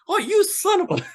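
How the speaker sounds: enemy surprised from behind, startled high shout; startled yell. Retold or imitated. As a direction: jump and yell as he spins round.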